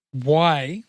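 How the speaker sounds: normal talking voice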